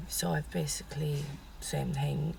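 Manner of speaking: real lost voice, mostly a whisper; words pushed out through a voice that has gone; whispering with no voice left. Real, not acted. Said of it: "my voice went".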